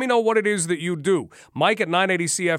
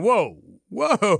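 alarmed whoa; 5 semitones higher than usual. Retold or imitated. Host voicing an alarmed listener hearing police news, startled whoa. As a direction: pull up short like a startled listener.

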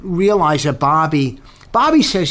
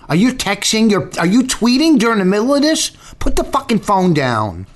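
annoyed scolding; 9 semitones higher than usual. Real, not acted. Scolding cohost for tweeting.

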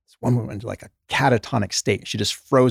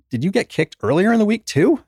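mock incredulous; 10 semitones higher than usual. Doctor confronting the patient's story.

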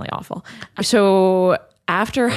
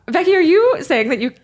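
asking while laughing hard, higher pitch; 10 semitones higher, 4 dB louder than usual. Real, not acted. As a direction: laugh through the question.

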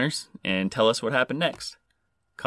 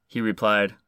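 normal talking voice

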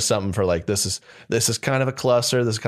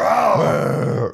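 loud, high creature roar; intimidating beast roar; 5 semitones higher, 4 dB louder than usual. Acted. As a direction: roar like a big movie beast. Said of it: "really kind of intimidating".

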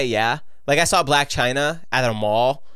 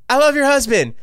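high, louder mock fan blurt; acted starstruck confession; retold gushing; 11 semitones higher, 5 dB louder than usual. Nervous fan gushing to wife, retold.

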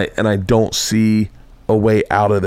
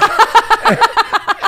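high burst of laughter. Real, not acted. Laughing at own story.

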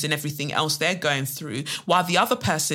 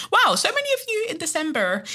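exaggerated amazement; 13 semitones higher than usual. Real, not acted. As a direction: gush in a much higher, playful voice. Mock amazement at letters about December.

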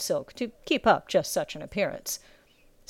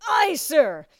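high, strained shout back; recruit's reply; 8 semitones higher, 4 dB louder than usual. A narrator's reading. Recruit to bellowing drill master, strained shout.

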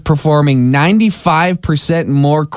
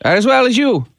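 put-on a light Irish accent on a prank call; 2 semitones higher than usual. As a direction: play an Irish caller hunting for his brother-in-law. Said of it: "Jim is gonna do his best Irish accent".

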